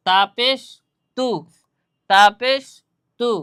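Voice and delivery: loud recitation of তাপেশ তু; drilling ta pesh. Shown as a demonstration. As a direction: call out তাপেশ, answer with a short তু. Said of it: "এর আগে আমরা কি পড়ছিলাম?".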